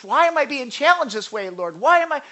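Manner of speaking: exasperated complaining to God, mildly hammed; mock whining complaint. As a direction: stamp your feet at the Lord.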